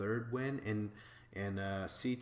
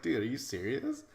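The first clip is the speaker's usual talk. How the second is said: mock annoyed disbelief, a bit louder; lightly voiced annoyed Derek; 4 semitones higher, 5 dB louder than usual. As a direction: imitate him caught off guard and irritated. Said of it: "so, like, annoyed".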